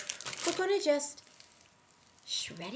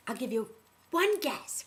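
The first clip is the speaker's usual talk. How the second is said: ordinary talk, nothing marked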